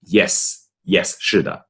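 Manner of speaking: normal talking voice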